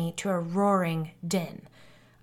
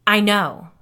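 loud, forceful retort with irritation; near growl; 9 dB louder than usual. A narrator's reading. Medium cutting off an irritated father, nearly growling. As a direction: snap it back, almost growling. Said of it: "I nearly growled".

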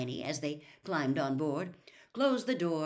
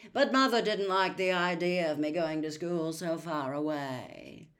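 louder, drawling boast; drawling Malfoy; 4 dB louder than usual. Acted. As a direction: show off with lazy contempt. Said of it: "familiar, drawling voice".

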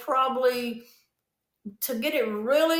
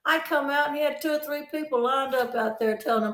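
ordinary talk

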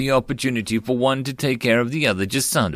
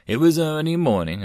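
usual speaking voice

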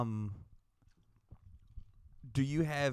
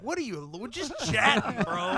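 higher laughing bro voice; dismissive bro; 6 semitones higher than usual. Retold or imitated. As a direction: brush it off like a bro. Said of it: "He's like".

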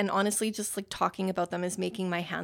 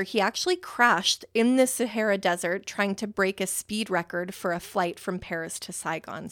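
normal talking voice

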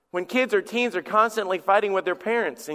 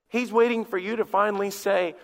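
usual speaking voice

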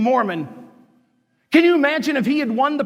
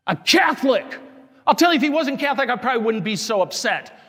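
raised, higher voice in real anger; angry preaching; 3 semitones higher, faster than usual. Real, not acted. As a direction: let the anger rise as you preach. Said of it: "sounds like I'm yelling at you".

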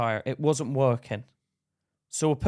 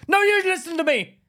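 high, loud mock yell; overbearing retold shout, pitched way up; raised voice; 14 semitones higher, 5 dB louder than usual. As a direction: imitate an angry man demanding to be heard. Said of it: "he's starting to raise his voice".

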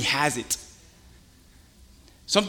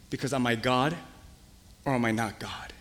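low quiet voice of God; 5 semitones lower, 8 dB quieter than usual. Pretend. God quietly reminding the believer who he is. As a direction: lower and softer, firm.

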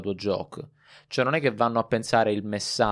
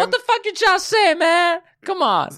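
high, loud angry heckler voice; aggressive heckler; 5 dB louder than usual. Retold or imitated. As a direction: bark it like a rowdy heckler. (imitazione di un disturbatore aggressivo)

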